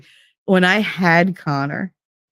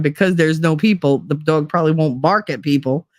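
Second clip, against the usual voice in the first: normal talking voice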